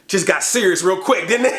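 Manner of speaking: ordinary talk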